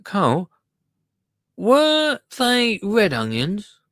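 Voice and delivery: high, drawn-out put-on accent with a rising question; Ray's accent. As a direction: put on a guessed regional accent, slow and high. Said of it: "let's do his accent".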